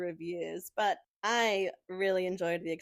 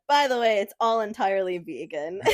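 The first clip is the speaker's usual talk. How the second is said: loud reveal; 8 dB louder than usual. Retold or imitated. Retold reveal at the door.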